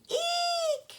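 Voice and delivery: very high squeaky eek; startled shriek. Acted. Little sister shrieking at the sticky web. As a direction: squeal in fright. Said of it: "She's going".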